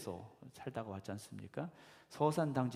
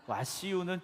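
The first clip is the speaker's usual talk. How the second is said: fuller Chungcheong dialect; 7 semitones higher than usual. Retold or imitated. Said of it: "조금 더 이제 좀 풀어서 쓴 거고".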